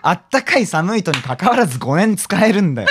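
high, loud comic protest; exasperated retort. Pretend. Comedian rejecting joke about chilled passport, loudly exasperated. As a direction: argue back in mock outrage.

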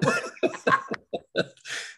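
a high, airy burst of laughter; high breathy laugh. Real, not acted. Host laughing at the guest's story about her husband, high and airy.

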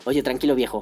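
the meme line said wrong and flat, close to his own voice; a lightly voiced cop fumbling a catchphrase; mangled meme quote, barely acted; cop botching the meme. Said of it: "lo dijo como".

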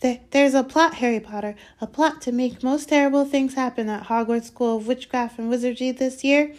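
high, urgent elf voice; whispering house-elf. A narrator's reading. Elf revealing a plot to Harry, urgent. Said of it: "whispered Dobby".

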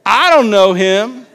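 emphatic acted protest; insistent denial. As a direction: deny it forcefully, stretching the words. Acts Peter's louder second denial.

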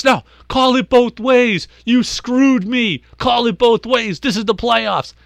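high, heated yelling, dragged out slow; going nuts. Retold or imitated. Ejected coach keeps ranting at the umpire, high and angry. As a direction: rant like a coach who lost it. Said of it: "the guy continues to go nuts".

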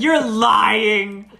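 loud retold disbelief; mock disbelief. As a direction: call out the friend, incredulous. Laughing friends disbelieve his account.

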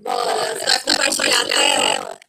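deep glitchy robotic voice. Real, not acted. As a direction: voice warped by a bad connection. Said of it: "você tá com uma voz robótica".

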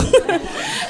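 shrill burst of laughter; high squealing laugh. Real, not acted. Cracking up at own bit.

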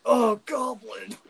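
high, loud, drawn-out mock moan; Goblin Slayer. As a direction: cry it out slowly in joking ecstasy. Imagines Goblin Slayer during sex.

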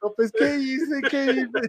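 high, loud questions breaking into laughter; slow, squealed words through real laughter; helpless laughing. Real, not acted. Laughing at own snoring story.

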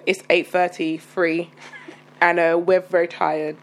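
drowsy, dragging pace; words dragged out from exhaustion; slow tired talk. Real, not acted. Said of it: "right now I'm talking very slow".

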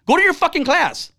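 high, forceful retold command; angry order. Dad refusing to negotiate with son, angry order.

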